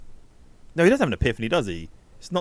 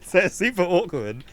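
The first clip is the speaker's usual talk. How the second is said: amused, higher-pitched, laughing through the words; 8 semitones higher than usual. Real, not acted. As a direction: crack up while talking. Laughs at the awkward scene.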